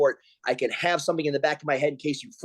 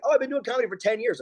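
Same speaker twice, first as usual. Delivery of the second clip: mock bragging; 8 semitones higher, faster than usual. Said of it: "it's like".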